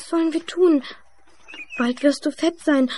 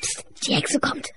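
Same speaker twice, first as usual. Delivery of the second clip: Flüstern; 7 dB quieter than usual. Acted. Warnung vor der Hexe.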